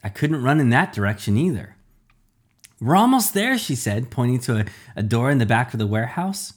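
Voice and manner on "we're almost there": high, loud call while running; urgent call. A narrator's reading. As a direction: call out breathlessly to keep going.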